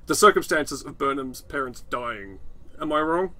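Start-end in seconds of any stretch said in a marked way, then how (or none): none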